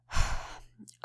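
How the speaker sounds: long, audible huff of breath; exasperated sigh. Acted. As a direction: sigh out at a smart mouth. Exasperated by kid's smart remark.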